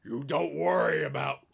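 loud goblin boss voice, slowing into the threat; menacing goblin. Acted. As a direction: shout them down, then draw out the ultimatum. Goblin answers back, repeats his threat.

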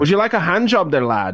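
high, hammed-up mock Scottish woman; mock Scottish lass. Joking about Scottish women's accent, high mock Scottish voice. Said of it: "that's more pirate".

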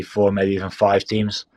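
ordinary talk

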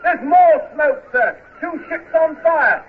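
distant high voice from aloft; far off call. Acted. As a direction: report down from the masthead. Lookout reporting from masthead.

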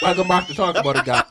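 fast, high, hyped-up show opener; hyped podcast intro. Acted. Host opening the podcast, fast and hyped up. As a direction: announce the show like a hype host. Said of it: "this is how we're supposed to start the podcast".

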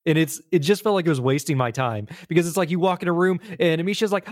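ordinary talk, nothing marked

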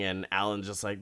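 ordinary talk

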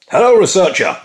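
loud, high hail to an assistant; calling out. Pretend. Presenter hailing his researcher on the programme, calling out. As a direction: call out brightly across the room.

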